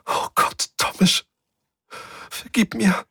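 breathy start, then a high thin whimper; whimpering plea. Acted. Svensson begs Thomas to forgive her, whimpering. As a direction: beg for mercy through tears. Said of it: "wimmerte Svensson".

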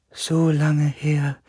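high, slow, drawn-out otherworldly voice; dying spirit's voice. A narrator's reading. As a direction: stretch it out faintly, like a fading spirit. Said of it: "sagte die Stimme". (hohe, gedehnte Geisterstimme)

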